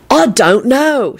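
slow, high, aggravated reply. A narrator's reading. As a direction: drag it out with exasperation. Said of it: "Sirius replied in an aggravated tone".

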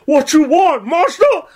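rising-pitched mock yell; retold challenge. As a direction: challenge it like a bold old-timer. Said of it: "And I says".

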